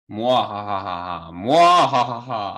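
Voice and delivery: slow, loud villain cackle; evil laugh. Pretend. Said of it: "What evil plans do you have for us today?".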